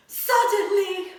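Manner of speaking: high, loud, drawn-out suddenly; big suspenseful reveal; dramatic suddenly. Acted. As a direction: make the kids jump on it. Dramatic story word.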